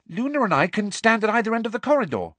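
fast, high, ready voice; brisk high-pitched suggestion; prompt. A narrator's reading. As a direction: offer the plan straight away. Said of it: "said Ginny promptly".

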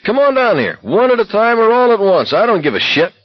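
high, furious screamed dare; screaming. A narrator's reading. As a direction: scream the challenge with pitch way up. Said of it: "McFreeze screamed".

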